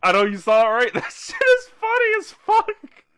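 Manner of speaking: loud, very high laughing squeal; helpless laughter breaking through the words; real cracking up. Real, not acted. Cracking up at something seen.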